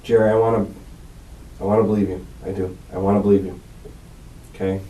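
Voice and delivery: low, hushed, earnest reassurance; gentle appeal. Real, not acted. Interrogator telling suspect he wants to believe him, gently appealing. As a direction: drop the voice right down and coax softly.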